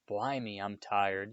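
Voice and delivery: usual speaking voice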